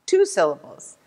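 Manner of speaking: ordinary talk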